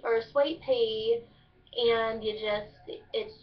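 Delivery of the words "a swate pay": slow southern "sweet pea" as "swate pay"; southern drawl. Shown as a demonstration. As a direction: draw out long A's, Kentucky style. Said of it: "take out the E's and you put A in there".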